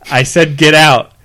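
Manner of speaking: loud, high command; screaming at him to leave the car; furious yell. A narrator's reading. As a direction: order him out at full voice. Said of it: "Teresa yelled".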